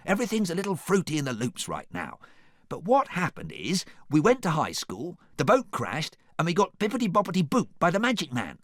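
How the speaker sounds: high, animated gabble; Donkey. A narrator's reading. Donkey explains the magic mix-up, animated and high. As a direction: rattle it off high and lively.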